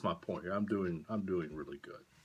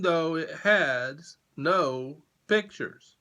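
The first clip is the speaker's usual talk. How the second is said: much higher, louder gushing; over-the-top flattery; grand praise; 11 semitones higher, 11 dB louder than usual. Acted. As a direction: gush over the kids.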